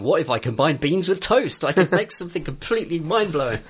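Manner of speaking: a hammed-up cook struck by genius; mock-inspired game chef musing; Ignis. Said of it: "He's just looked at this poor woman's food and gone".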